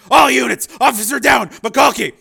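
loud, high emergency radio call; officer-down distress shouting; urgent shout. A narrator's reading. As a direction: yell for backup in alarm. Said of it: "Steele shouted".

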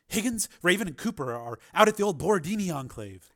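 Sharp, informative. Acted. Sharp explains where allies are.

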